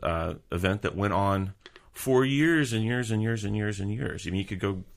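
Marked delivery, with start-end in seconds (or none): none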